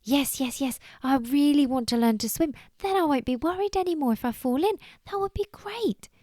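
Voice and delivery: eager high-pitched little bunny; excited. Acted. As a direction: burst out, rising with delight. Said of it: "Billy Bunny's eyes went big and round".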